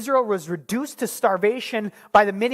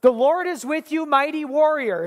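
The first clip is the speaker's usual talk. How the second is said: higher, bright announcing call; bold proclamation; 7 semitones higher, 3 dB louder than usual. Acted. God hailing hiding Gideon, a bold high proclamation. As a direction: proclaim it like a herald. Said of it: "God comes and says".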